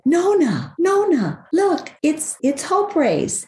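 put-on high, childlike voice calling Nona; a small boy pointing up at the sky, thrilled; excited little grandson. Grandmother imitating her grandson spotting a rainbow, high childlike excitement.

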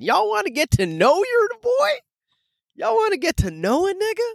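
rising hype, pulling the audience in; hyped invitation. Acted. Host invites listeners to know him, high and hyped.

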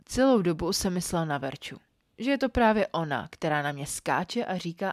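usual speaking voice

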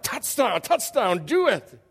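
loud, high, hammed-up cheering; mock crowd frenzy; excited urging. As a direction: egg a baby on like a whole excited room. Whole room urging the baby.

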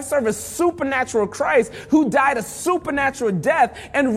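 high-pitched, impassioned sermon delivery; rousing pulpit climax; fervent preaching. Real, not acted. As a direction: preach it with mounting fervor, pitch climbing. Preaches with rising fervor.

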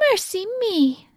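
gasp, then exclaim in a high voice; high-pitched old woman taken by surprise; gasping storybook astonishment; startled old woman. A narrator's reading.

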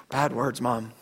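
low, very soft admission after a sigh; hushed confiding child. Retold or imitated. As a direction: confide it to Mom under your breath. Son confides about bad words.

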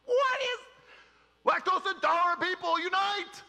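very high, loud mock disbelief; mock incredulous. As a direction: squeak it out in disbelief. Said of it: "I'm like".

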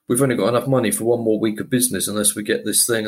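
rattled off quickly like Lewis; fast Lewis quote. Retold or imitated. Lewis explaining the money problem, rattled off fast. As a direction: rush it out in one breath. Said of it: "Lewis is like".

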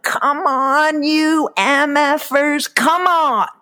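high-pitched, slow, drawn-out challenge; crazed scream. Retold or imitated. Woman retelling how she faced down intruders, crazed yell. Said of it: "I was screaming. My voice got really deep, like, you know, like a crazy person".

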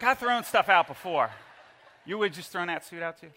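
normal talking voice

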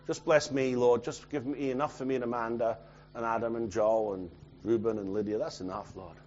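low, soft imitation of a modest prayer; mock meek prayer. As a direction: pray small and quiet, fading away. Imitates a small, selfish prayer.

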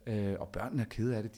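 ordinary delivery

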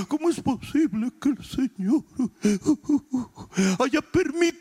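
exaggerated sobbing complaint, words stretched out; mock wailing. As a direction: break into hammed-up sobs of self-pity. Mimics self-centred believer wailing complaint.

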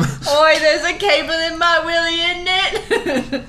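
high, loud, laughing cockney imitation; mock British accent. Friend quoting the TikTok teen, laughing mock British accent. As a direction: ham up a London lad through giggles. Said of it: "Imagine being British and having to say that".

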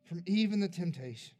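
a pastor's altar-call prayer, swelling; fervent prayer. Acted. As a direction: pray over them with building conviction. Closing prayer over the people.

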